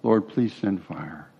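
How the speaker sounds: softly retold prayer, slow and understated; little prayer. Elijah asking the Lord for fire, understated prayer. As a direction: pray it briefly, quiet and unhurried. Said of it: "prays this one little prayer".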